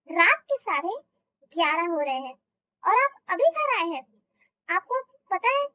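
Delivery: ordinary talk, nothing marked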